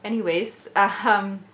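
usual speaking voice

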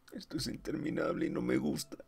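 pitched high and frantic, near breaking; high panicked whine. Acted. Stimson, adrift and alone, whines in high panic. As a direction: let the fear spill over into a plea.

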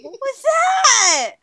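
startled yelp. Real, not acted. A shrieked reaction.

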